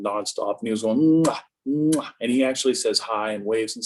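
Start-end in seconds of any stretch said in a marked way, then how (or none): 1.2-2.1 grandson's smacking "mwah" kisses; playful kiss sounds, pitched up a little; imitated kiss noises. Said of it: "he was giving me kisses".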